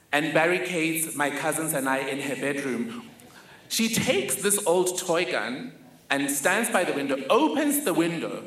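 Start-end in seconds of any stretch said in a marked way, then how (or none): none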